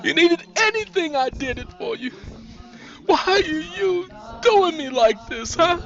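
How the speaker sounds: high, tearful, anguished appeal; desperate pleading. A narrator's reading. Sandra begging her friend for her life, tearful. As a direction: beg her, near tears.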